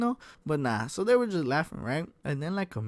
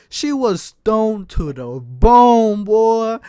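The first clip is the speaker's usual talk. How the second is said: slow, loud, stretched-out punchline; drawn-out emphasis; 6 semitones higher, 10 dB louder, much slower than usual. Real, not acted. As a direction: lean hard on every word. Punchline about stoned girl.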